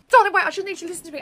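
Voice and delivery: loud, fast, breathless plea; urgent plea. Acted. Friend begs Reese on the phone, loud hurried plea. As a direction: rush to make him listen.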